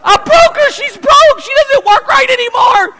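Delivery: put-on high, loud childish wail; a kid sure he broke his mom; high crying child's voice; distraught little boy. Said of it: "I went".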